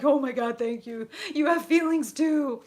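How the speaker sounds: high, loud, hammed-up relief; mock emotional gushing. Voicing the Cancer moon's reaction, hammed-up emotional outburst. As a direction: burst out high and loud, overcome with gratitude. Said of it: "that's like".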